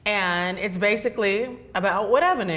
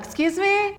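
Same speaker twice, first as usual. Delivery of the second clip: high, baffled excuse me; imitating a confused newcomer; puzzled excuse me; 12 semitones higher than usual. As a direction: act like you didn't catch a word. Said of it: "And you're like".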